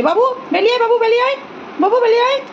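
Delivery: bright coaxing call to the nest; high calling out. Real, not acted.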